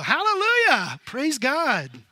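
loud, high, exultant praise; joyful shout. Retold or imitated. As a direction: shout it out like a revival crowd. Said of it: "I go".

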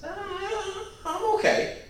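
high-pitched mock self-reassurance; mocking, airy denial of any fault; self-deceiving false teacher. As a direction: wave it off in a high, put-on voice. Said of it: "You false teachers, those who would fool yourselves".

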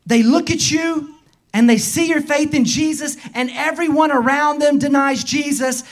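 driving, repetitive pulpit cadence; insistent preaching. Real, not acted. Preacher listing those who deny Jesus, insistent preaching. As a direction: pile up each example with mounting urgency.

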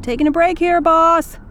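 volunteer dad calling over. Acted. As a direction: call to a colleague. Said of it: "calls out".

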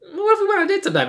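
very high, fast squeaky mock ask; mock Randy. Mocking Randy's casual date ask, very high and fast. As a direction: squeak it out like a timid suitor.

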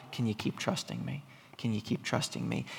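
soft, low, gentle asking; tender shepherd. Acted. The Good Shepherd asking Much Afraid to keep trusting, softly. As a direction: coax her on quietly. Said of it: "He leads her".

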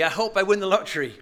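mock the wishful punter; lightly voiced lottery hopeful. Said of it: "it's not like".

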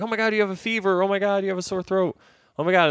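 high, fast, fussing mother voice; fussy mom. Retold or imitated. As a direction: panicky mom, oh my God. Mom fussing over flu symptoms.

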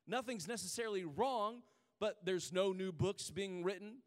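normal talking voice

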